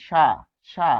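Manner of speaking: "সা" said as a clear sha; demonstrated pronunciation. Shown as a demonstration. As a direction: model "সা" with a sh sound. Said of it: "উচ্চারণ কি?". ("সা" শা-র মতো উচ্চারণ)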